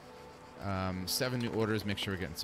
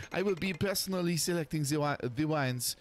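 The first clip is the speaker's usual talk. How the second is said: put-on French accent; 3 semitones higher than usual. Sophie voice continues.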